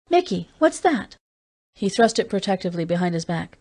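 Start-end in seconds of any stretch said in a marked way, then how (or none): 0.1-1.2 higher, slow sharp question; alarmed Julie. A narrator's reading. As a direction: ask the child in sudden alarm.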